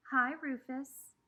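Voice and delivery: sweet drawn-out greeting. Acted. Girls greeting the cute new boy, slow sing-song. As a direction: coo the name at the new boy.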